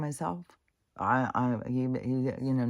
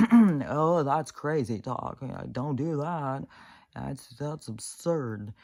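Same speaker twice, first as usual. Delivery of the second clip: slow, higher mock voice of a scornful relative; loud scoffing Oh, then slow sneer; mocking dismissal; 3 semitones higher, 5 dB louder, slower than usual. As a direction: belittle the idea, drawn out. Mocking family's dismissal of ideas.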